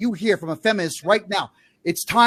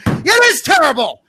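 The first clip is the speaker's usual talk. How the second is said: high, loud, fast furious yell; angry yell; 10 semitones higher, 3 dB louder than usual. Real, not acted. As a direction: blast the parents, furious. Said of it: "Now I'm gonna yell at you for this".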